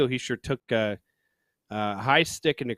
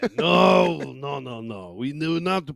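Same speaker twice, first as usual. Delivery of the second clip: put-on French Canadian accent, rising inflection; 2 semitones higher than usual. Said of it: "The upward inflection".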